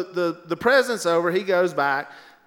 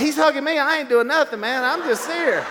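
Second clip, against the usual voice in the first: higher, louder, innocent protest; excited protest; 8 semitones higher, 4 dB louder than usual. Retold or imitated. To the Secret Service agent, high innocent protest. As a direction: plead innocence, laughing.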